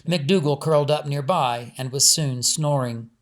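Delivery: usual speaking voice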